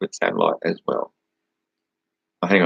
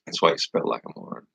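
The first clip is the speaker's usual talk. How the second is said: muttered aside; 7 semitones lower, 5 dB quieter than usual. Real, not acted. Snide aside about the chat user.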